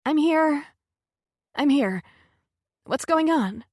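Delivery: high, loud, choked reply after crying; thick tearful voice. Acted. Answering her friend after crying, thick tearful voice. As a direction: answer through tears, trying to sound fine. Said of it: "she can hear the thickness of my voice".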